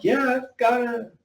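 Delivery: high, eager, retold excitement; excited reply. Telling David he also loves Torkers, excitedly. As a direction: perk up about Torkers, pitch jumping high. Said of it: "And I'm like".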